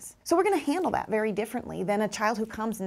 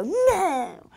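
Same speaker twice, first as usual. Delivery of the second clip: put-on high, loud child's no; upset child's no; 9 semitones higher, 5 dB louder than usual. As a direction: blurt no like a hurt child. Teacher voicing upset child.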